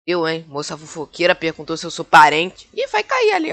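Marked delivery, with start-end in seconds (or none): 2.7-3.5 high mock voice. Mock voice for driver.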